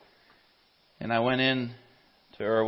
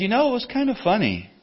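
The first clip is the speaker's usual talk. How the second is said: higher-pitched voice for the wife; lightly voiced wife, raised pitch; retold wife; 11 semitones higher than usual. Retells dead neighbor's wife speaking.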